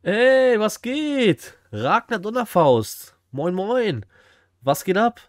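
loud, high hype greeting to an arriving viewer; excited greeting. Real, not acted. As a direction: hail them loudly with drawn-out, high-pitched words.

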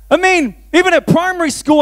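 ordinary talk

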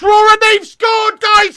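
high, loud roar at a goal conceded; dismayed shout. Real, not acted. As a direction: cry out in horror. Opponents score.

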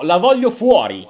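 ordinary talk

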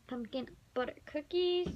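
ordinary talk

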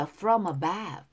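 "above" stressed on its second syllable. Shown as a demonstration. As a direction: link "from above" and lean on bove. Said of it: "above의 액센트가 있어요". ("above"의 두 번째 음절에 강세)

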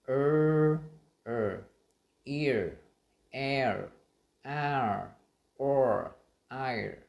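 "er, ear, air, are, or, ire" one by one, loud and drawn out; vowel drill. Shown as a demonstration. Teacher reviewing the sounds, loud isolated drill. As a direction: recite each from "er" to "ire" with a full r glide. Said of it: "chúng ta sẽ cùng, à, nhìn lại những cái âm này".

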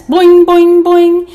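loud, high run of spring noises; repeated bouncing boings. A narrator's reading. Lamb bounces across the yard, loud high boings. Narrator imitates spring jumps.

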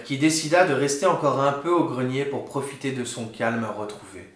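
usual speaking voice

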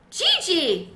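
high, loud squeaky call; squeaking yell. A narrator's reading. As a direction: yell her name at full lung. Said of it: "squeaked at the top of my lungs".

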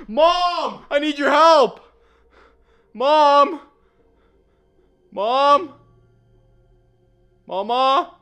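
loud shout for help; calling for Mom. Pretend. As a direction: yell to someone in another room.

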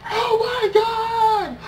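high, drawn-out mock crowd scream; over-the-top screamed disbelief, stretched way out; imitated screaming reaction. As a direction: wail it out long like a stunned fan. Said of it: "Everyone was screaming their reactions like".

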